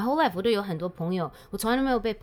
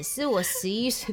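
usual speaking voice

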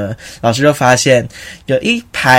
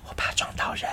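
soft unvoiced whisper; 18 dB quieter than usual. Answering a friend mid rehearsal, whispered. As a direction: murmur it under your breath. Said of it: "我就很小声的跟他说".

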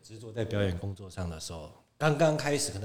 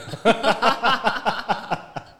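long, loud, high peal; big laugh; 12 semitones higher, 10 dB louder than usual. Real, not acted. Guest cracks up at own size joke, big laugh. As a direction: laugh uproariously.

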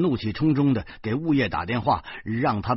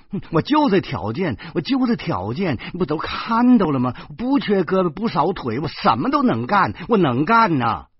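loud, high-pitched, emphatic insisting; insistent job-seeker; 6 semitones higher, 4 dB louder than usual. A narrator's reading. Job-seeker to employer, still mopping, loudly insistent. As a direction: press your case hard, higher and louder.

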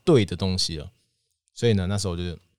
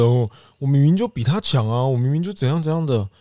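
raised, louder defiant complaint; indignant protest; 6 semitones higher, 6 dB louder than usual. Retold or imitated. Young student inwardly arguing against teacher's advice, indignant. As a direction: protest as if badly wronged.

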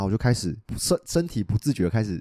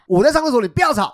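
high, loud, laughing mock outburst; furious girlfriend; 9 dB louder than usual. Girlfriend yelling from the toilet, loud mock fury. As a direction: snap it back, hammed up with a laugh. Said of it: "我女朋友暴怒".

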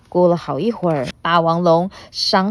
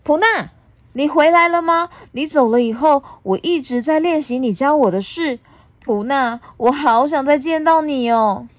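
higher, fond and eager voice; happy uncle dinosaur; 8 semitones higher than usual. Acted. T-rex welcoming Puna home, fond and eager. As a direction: welcome her back gladly.

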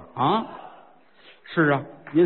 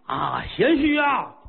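put-on dying old man's cry; 6 semitones higher, slower than usual. Old man dying after poisoned wine.

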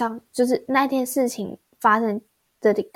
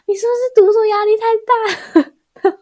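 much higher and louder, a put-on parent's voice; imitating a worried parent; 11 semitones higher, 6 dB louder than usual. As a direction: mimic the fretting parent guessing wrong. Parents guess it's study stress.

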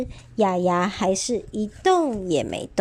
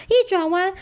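plain reading voice

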